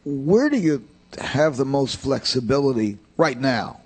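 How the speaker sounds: usual speaking voice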